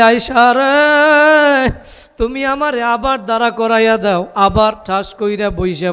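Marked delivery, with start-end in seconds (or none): 0.0-1.7 high, slow, stretched call. Acted. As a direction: cry her name in a long sung note. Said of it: "আয়েশাকে ডাক দিয়া বলে". (উঁচু গলায় টেনে ডাক)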